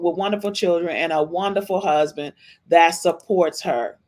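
ordinary talk

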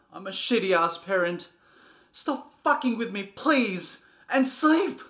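loud, high, pleading shout; begging at the top of the voice; desperate yelling. Acted. Broken parent yelling a plea at the child.

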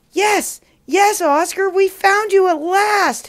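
high, loud relieved cries; overjoyed. Acted. Parents find their lost boy, high excited joy. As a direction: cry out with joy at finding him.

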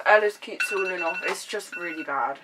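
face covered by wet mask; speaking with mask on face; through a sheet mask. Real, not acted. Seen 4: Both visible people wear sheet masks throughout; speaker unclear.

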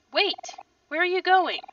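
high, loud call after someone; urgent call. Acted. Kel calling after Aubrey as she leaves, urgent call. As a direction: call out to stop them leaving.

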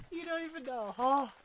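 ordinary delivery

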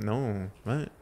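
normal talking voice

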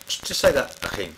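normal talking voice

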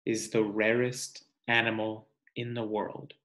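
ordinary delivery, nothing marked

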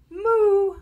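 high, loud moo; cow moo. A narrator's reading. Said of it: "He can sound like a cow".

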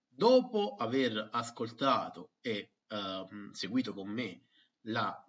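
normal talking voice

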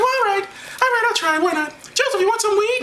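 high falsetto woman's voice; pitched far up, comic falsetto; high-voiced Mary. Acting Mary in comic retold dialogue.

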